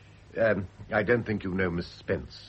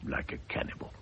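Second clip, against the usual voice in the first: whispered low and teasing; an intimate teasing whisper; 7 dB quieter than usual. Acted. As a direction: breathe it close to her ear. Lovers murmuring in private.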